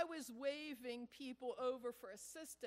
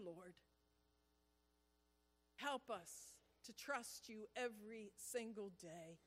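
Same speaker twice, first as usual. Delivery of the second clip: earnest pastoral prayer voice. Acted. Pastor leading the congregation in closing prayer, earnest.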